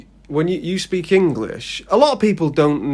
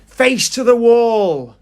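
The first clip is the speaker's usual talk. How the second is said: raised, commanding teacher voice; strict teacher; 7 semitones higher, 4 dB louder than usual. Acted. Bad teacher to a class, strict and commanding. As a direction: order it loudly, stretching the last words.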